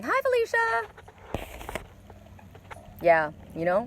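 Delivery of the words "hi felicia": high, slow, sing-song dismissal; sarcastic goodbye sung out high and slow; mock bye Felicia. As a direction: wave him off with a drawn-out high sneer.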